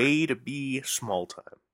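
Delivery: slow, stretched WAY TO BE SMALL TIME; drawn-out repeat. Real, not acted. Host needling his co-host for spoiling the question, slowly drawn out. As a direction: drag out each word of the jab.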